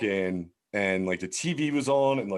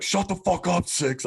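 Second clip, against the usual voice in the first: lightly acted high-pitched telling-off; mock an irritated cop silencing a cell; cop's order; 9 semitones higher than usual. Cops order a prisoner to quiet.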